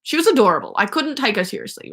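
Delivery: normal talking voice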